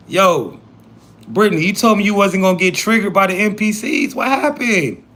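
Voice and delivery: high-pitched incredulous question; mock-dismayed, high-voiced questioning. Incredulous at Brittany's outburst.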